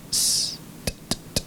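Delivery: unvoiced "s, t" and "t, t" with no vowels; bare consonant sounds. Shown as a demonstration. Teacher isolating s and t sounds for students. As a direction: hiss and tap the consonants alone. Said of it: "pronunciar el sonido de la T y de la S seguido".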